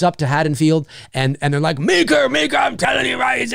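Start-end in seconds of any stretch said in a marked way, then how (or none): 1.8-3.5 high, urgent acted pleading; worked-up mock alarm; frantic townsfolk. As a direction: plead with the sheriff in a hammed-up high voice.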